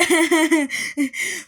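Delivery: high, loud fake laugh; hammed-up giggling burst; imitated laughing fit. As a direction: mimic a kid laughing for attention. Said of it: "It was like".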